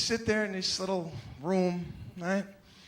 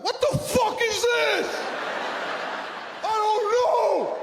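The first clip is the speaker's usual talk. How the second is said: furious father yelling. Retold or imitated. Father screams at his son over a flat bike tire, furious shout. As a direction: blast it like an enraged dad. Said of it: "my immediate reaction is to yell".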